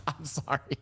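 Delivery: ordinary talk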